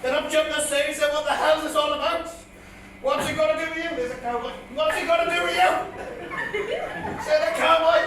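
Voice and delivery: projected, singsong ballad telling; comic poem recitation. Acted. Comedian reciting his dad's old bit as a tribute, declaimed comic verse. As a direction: declaim the rhyme to a crowd.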